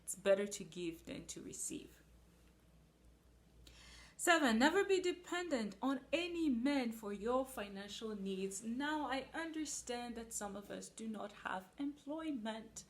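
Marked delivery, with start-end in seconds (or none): 4.2-8.6 loud, high proclaiming of the rule; preaching a rule. Acted. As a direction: announce it from the pulpit. Announcing rule number seven.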